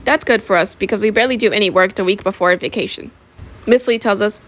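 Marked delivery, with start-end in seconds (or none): none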